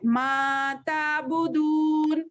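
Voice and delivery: मा ताबुदून with the ू in ताबुदून drawn long; elongated madd. Shown as a demonstration. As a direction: stretch the दू in ताबुदून slowly. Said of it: "ये भी हुर्फ मद्दा है". (ताबुदून का दू खींचकर)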